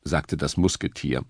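usual speaking voice

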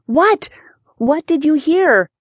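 high-pitched, eager questioning. A narrator's reading. Girl asking her cousin for the rumor, eagerly. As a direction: press for the news eagerly, voice lifted high. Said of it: "Rebecca asked eagerly".

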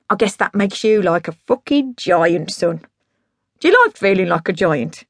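emphatic, swelling then dropping to confide; drunk dad pitching. Acted. Dad putting his cassette in Rock's hands, grandiose. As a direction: press it on him with boozy grandeur.

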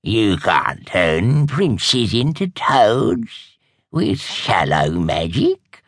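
slow, gloating crone savoring wicked memories; relishing old witch. Acted. Old witch lecturing the young witches, slow and gloating. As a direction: linger over every cruel delight.

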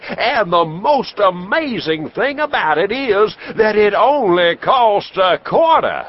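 high, booming medicine show pitch; snake-oil salesman. Acted. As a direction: sell it hard to the crowd like a huckster. Traveling professor sells his elixir.

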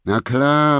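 indignant shouted comeback; yelling back. A narrator's reading. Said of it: "brüllte ich zurück".

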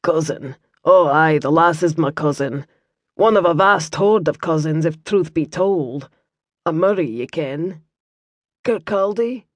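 light Scottish burr, calm and kindly; Scottish monk. Acted. Brother Matthew explains to Liam in a mild Scottish accent. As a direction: explain gently as a Scots monk.

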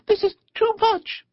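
very high, overwhelmed squeal; ecstatic. A narrator's reading. Mole overwhelmed by the picnic, ecstatic. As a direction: squeak it, overcome with delight. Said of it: "cried the Mole in ecstasies".

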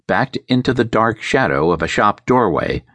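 ordinary delivery, nothing marked

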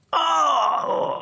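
long, high yell of pain; loud yell. A narrator's reading. Finger knocked by accident, high pained yell. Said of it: "I can't help yelling really loud".

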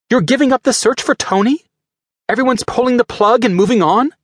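high, accusing and upset; angry Pepper. Acted. Pepper accuses Rhodey of giving up on Tony, angry high voice. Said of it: "she asked angrily".

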